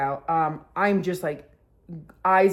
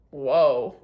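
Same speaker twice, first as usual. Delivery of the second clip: low, stunned exhale; 5 semitones lower than usual. Retold or imitated. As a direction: drop low in dazed amazement. Acting out stunned reaction on sitting.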